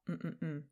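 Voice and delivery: three soft, low hums; hummed blank. Shown as a demonstration. Teacher hums the quiz sentence's gap, soft low hums. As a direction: hum softly for the missing word. Hums in place of missing word.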